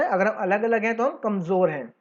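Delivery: ordinary talk, nothing marked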